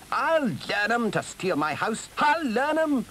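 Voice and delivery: high, blustering battle cry; indignant cry. A narrator's reading. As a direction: rehearse your revenge, crying out high. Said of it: "he cried".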